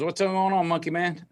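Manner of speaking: dad's cheery greeting. Retold or imitated. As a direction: greet your son like a playful father. Dad answering son's phone call.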